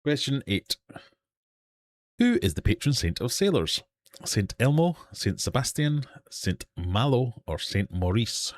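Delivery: high, projected quizmaster announcement; game-show question read, starting high and stepping down; quiz host. Acted. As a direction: announce the question and options to the room.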